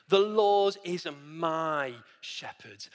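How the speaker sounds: "my" drawn out, set apart in a slow line; slow down and lean on "my"; stress on my. Shown as a demonstration. Preacher stressing the psalm's personal claim, emphatic "my". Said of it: "I want to emphasize the word "my".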